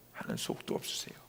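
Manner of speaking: low, quick whisper to God; barely audible retold prayer. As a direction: let the voice drop away into a whisper.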